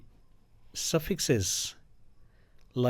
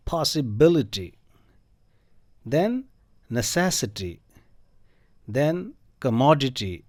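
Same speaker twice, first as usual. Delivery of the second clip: each word read slowly, its third-last syllable stressed; stressed word list; much slower than usual. Shown as a demonstration. Teacher reading example words aloud, slow and stressed. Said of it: "उन पे हमें stress देना है".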